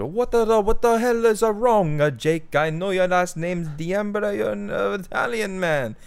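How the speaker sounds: hammed-up Italian manager blurting out in protest; loud Italian Mario. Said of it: "fucking Guido named Mario".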